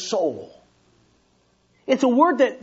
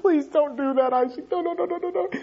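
higher, quiet pleading no's; pleading inner voice; 6 semitones higher, 3 dB quieter, faster than usual. Retold or imitated. Father pleading with his son Isaac in his mind, high and soft. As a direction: beg Isaac silently in your head. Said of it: "I just think".

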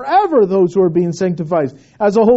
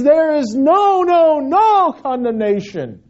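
each "no" drawn out and raised; emphatic stress on NO; 7 semitones higher, 4 dB louder, slower than usual. Shown as a demonstration. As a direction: hammer "no, no, no" slowly before "condemnation". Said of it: "It's emphatic, it's emphasized".

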